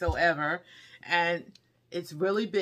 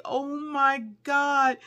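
awed gasp; 6 semitones higher, much slower than usual. Retold or imitated. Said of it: "I was like".

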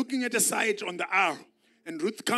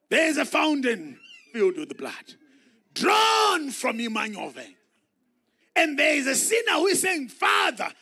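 louder and higher, shouted sermon climax; preacher shouting to stir the church; full-force preaching; 8 semitones higher, 6 dB louder than usual. Acted. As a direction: preach it out loud to the congregation. Preacher calling hymn line.

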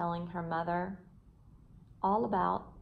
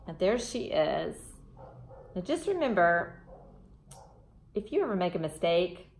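ordinary delivery, nothing marked

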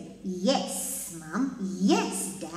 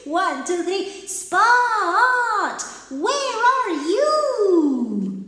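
louder call; 4 semitones higher, 6 dB louder, much slower than usual. Shown as a demonstration. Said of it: "Más fuerte tenemos que decirle".

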